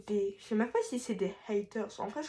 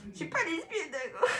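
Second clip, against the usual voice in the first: giggling, higher half-joke; laughing denial; 7 semitones higher than usual. Real, not acted. To viewers after her joke, laughing.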